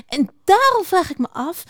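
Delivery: high-pitched excited mouse; opgewonden veldmuis. Acted. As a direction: bubble over with a pressing question. Opgewonden veldmuis springt van spanning. (hoog en opgewonden)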